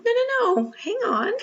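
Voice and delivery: high, loud rush of "no, no, no"; urgent protest. Retold or imitated. Stopping the kind woman from waving off the damage, high urgent protest.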